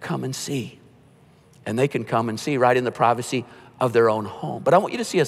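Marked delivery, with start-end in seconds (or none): 0.0-0.8 soft invitation. Shown as a demonstration. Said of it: "your chance to say".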